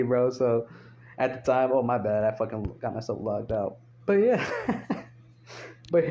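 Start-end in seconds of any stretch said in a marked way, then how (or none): none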